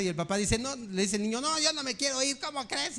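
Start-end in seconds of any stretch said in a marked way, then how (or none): 1.4-3.0 put-on whiny kid refusing, high-pitched; mock child's protest in a high voice; tantrum-throwing child. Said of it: "empieza a gritar al papá y empieza a hacer berrinche".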